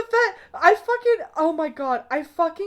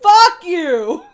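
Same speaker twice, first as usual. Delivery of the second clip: playful yell; 4 semitones higher, 9 dB louder than usual. Real, not acted. Shouted playful retort.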